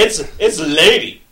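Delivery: smooth seducer, lightly put on; a hint of an oily charmer; ladies man voice. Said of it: "There's my worst ladies man pr-, uh, impression".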